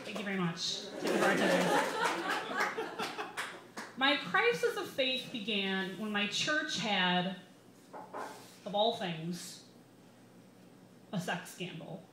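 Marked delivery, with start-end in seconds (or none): none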